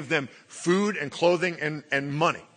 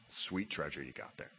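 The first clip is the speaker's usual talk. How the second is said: sarcastic aside; 11 semitones lower, 14 dB quieter than usual. Acted. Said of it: "sarcastic aside".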